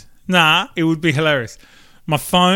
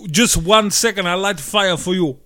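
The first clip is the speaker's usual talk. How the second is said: mock Russian accent; 7 dB louder than usual. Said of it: "he'd just be like".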